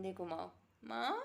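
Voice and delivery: ordinary talk, nothing marked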